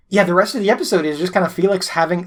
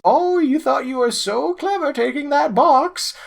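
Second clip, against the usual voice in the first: much higher, smug gloating villain; 9 semitones higher than usual. Retold or imitated. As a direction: sneer it from up high, very pleased. The Twister gloating.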